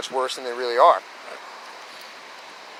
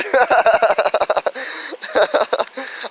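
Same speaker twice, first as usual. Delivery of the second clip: real high laugh at the memory; real laughter; amused laugh; 11 semitones higher than usual. Real, not acted. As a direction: laugh at how absurd it was. Laughing at own story.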